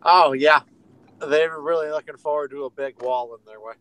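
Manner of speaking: mock-enthusiastic agreement, opening with a loud "oh, yeah"; sarcastic. Real, not acted. Guest scoffing at a wall in their way, sarcastic. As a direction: feign eager approval, starting loud. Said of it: "was that sarcasm?".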